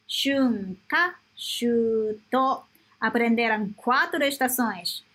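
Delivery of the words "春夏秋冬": 春夏秋冬 said slowly, each kanji reading drawn out; slow model reading. Shown as a demonstration. Teacher slowly saying the four seasons to close the lesson. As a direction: read 春夏秋冬 slowly and clearly. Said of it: "slow summary reading".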